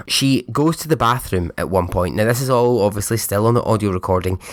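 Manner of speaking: ordinary talk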